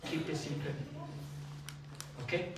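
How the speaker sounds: soft, low hushed "Keep the secret."; secretive adult. Acted. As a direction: lean in as if sharing a secret. Adult voiced telling a secret.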